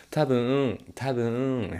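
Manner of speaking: loud, high, falling "Tabung, tabung."; Japanese speaker imitation. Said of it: "orang Jepang sering ngomongnya gitu".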